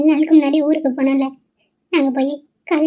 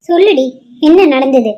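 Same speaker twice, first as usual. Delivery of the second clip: angry demand; 7 dB louder than usual. Acted. Mother demands the truth.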